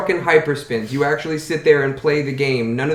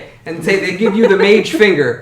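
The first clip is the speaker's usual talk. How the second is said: excited; 7 semitones higher, 7 dB louder than usual. Real, not acted. GM mocks failed sleight of hand.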